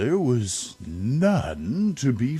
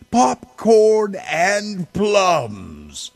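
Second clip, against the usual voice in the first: high, loud, slow end of the list; gleeful list; 11 semitones higher, 6 dB louder, slower than usual. A narrator's reading. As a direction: land it with relish.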